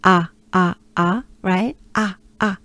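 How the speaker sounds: wide open exaggerated A; big open A. Shown as a demonstration. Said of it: "big opening, exaggerate it".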